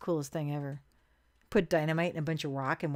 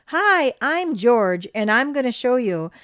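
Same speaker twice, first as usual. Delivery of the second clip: slow, loud, higher TV host greeting; TV host intro; 4 semitones higher, 9 dB louder than usual. Retold or imitated. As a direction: announce it like a show host. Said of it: "he would say".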